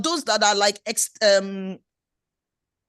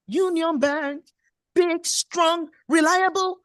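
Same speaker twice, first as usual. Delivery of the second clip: rising higher with each word, louder; admiring praise; 6 semitones higher, 3 dB louder, much slower than usual. Retold or imitated. Wife praising husband in bed, rising excited admiration. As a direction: hype him up, gushing with pride. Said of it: "I had to vocalize my affirmation".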